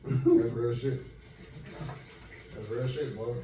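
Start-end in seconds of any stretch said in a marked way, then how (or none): none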